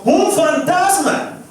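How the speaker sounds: leve grito asustado, agudo y lento; grito de miedo. A narrator's reading. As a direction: como discípulo que ve un fantasma. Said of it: "Se turbaron diciendo". (grito de miedo leve, agudo y lento)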